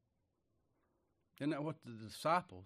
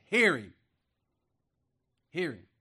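commanding voice from heaven; 2 semitones higher, 10 dB louder than usual. Acted. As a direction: command them to listen. Voice of God commanding.

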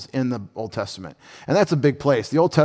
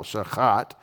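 shachat stressed at the end, sha-KHAT; accent on last syllable; 3 semitones lower, 4 dB quieter than usual. Shown as a demonstration. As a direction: lean on the second syllable of shachat. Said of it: "accent the second syllable, or the last syllable".

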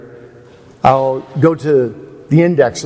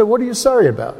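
Spurgeon puzzled; 8 semitones higher, faster than usual. Retold or imitated. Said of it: "Spurgeon said".

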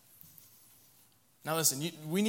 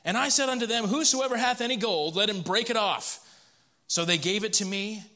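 higher, louder, storytelling read; dramatic scripture reading; 3 semitones higher, 5 dB louder than usual. Acted. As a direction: read Aaron's tale with flair. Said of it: "Verse number twenty-two".

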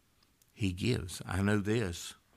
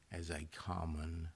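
usual speaking voice

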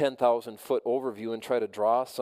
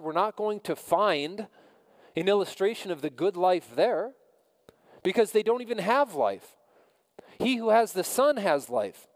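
preaching with rising fervor; 7 semitones higher than usual. Acted. Pastor to the congregation, building emphatic preaching. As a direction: climb into the point like a preacher.

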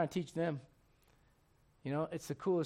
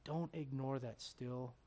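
gentle closing plea; 6 semitones lower, 12 dB quieter, much slower than usual. Real, not acted. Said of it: "still, small, and gentle voice".

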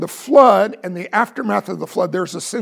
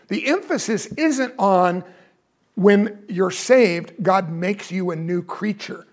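usual speaking voice

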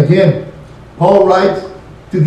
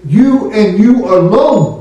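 each YOU drawn out, slow and deliberate; very emphatic YOU; 3 semitones higher, slower than usual. Shown as a demonstration. Preacher giving the translation, very emphatic on YOU. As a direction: stretch YOU and ALONE for full weight. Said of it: "It is so emphatic".

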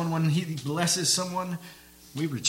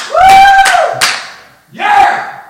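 wild, high whoop and yell; cheering crowd; 22 dB louder than usual. Acted. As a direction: cheer like a fan in the stands. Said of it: "everyone's like".

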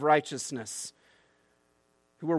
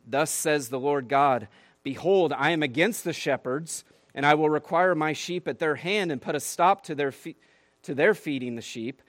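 solemn scripture proclamation; 4 dB louder than usual. Acted. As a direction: let each phrase land like a verdict from God. Reads God's words.

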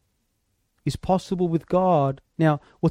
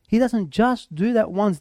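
ordinary delivery

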